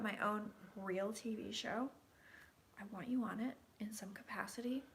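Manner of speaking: hushed, secret whisper; whispered pledge. Real, not acted. Whispering a drunken pledge to Casey, conspiratorial. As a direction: lean in and whisper the promise.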